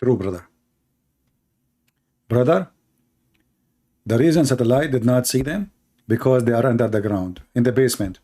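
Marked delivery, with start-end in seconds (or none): none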